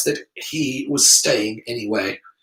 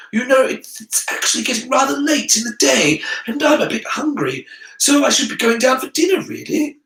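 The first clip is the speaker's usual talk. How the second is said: put-on higher-pitched boy making polite excuses; uneasy Harry; 9 semitones higher than usual. Harry excusing himself to the headmaster, uneasy and pitched up. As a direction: babble politely, pitched up, eager to get away.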